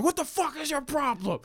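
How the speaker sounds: hammed-up outrage from the parked car; retelling an angry stranger's yell; imitated angry yell. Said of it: "he's yelling at me".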